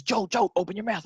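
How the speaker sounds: insistent mock call for attention. Man lobbing popcorn at Joel, calling out.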